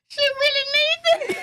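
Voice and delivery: very high, half-laughing mock wail; squealed realisation. Mother realises her child needs hugs, high comic wail.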